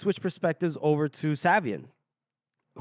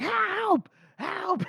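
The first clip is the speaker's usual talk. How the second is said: high-pitched mock cry for help. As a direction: call out for help in a high, strained retold voice. Running out, crying for help.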